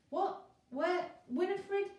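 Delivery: high, loud confused burble; burbling Zeus. A narrator's reading. Zeus just popped up on Pegasus, burbling in confusion. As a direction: splutter it like a befuddled old god. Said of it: "he burbled".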